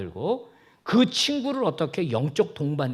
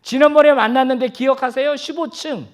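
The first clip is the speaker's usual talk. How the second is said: very high, loud and chirpy; eager small talk; 10 semitones higher, 10 dB louder than usual. Shown as a demonstration. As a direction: strike up a chat with exaggerated eagerness. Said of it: "대화를 시도해요".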